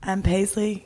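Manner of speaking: normal talking voice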